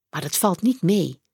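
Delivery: usual speaking voice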